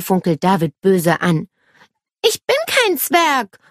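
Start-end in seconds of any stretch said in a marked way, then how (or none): 2.2-3.6 high, slow cry of protest; indignant calling out. A narrator's reading. Nele glaring at her brother, indignant cry. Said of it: "ruft sie".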